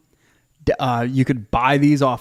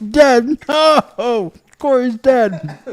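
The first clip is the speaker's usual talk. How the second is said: laughing; 10 semitones higher, 6 dB louder, much slower than usual. Real, not acted. Laughing at cohost choking.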